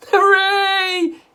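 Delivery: high, loud cheer; gleeful shout. A narrator's reading. Mister Cranky cheering Grandma's vanishing, high and loud. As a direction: cheer with glee. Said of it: "shouted Mister Cranky".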